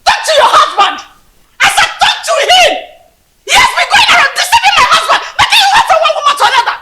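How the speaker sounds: furious shouting. Acted. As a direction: yell an angry warning at full voice. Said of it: "you need to calm down".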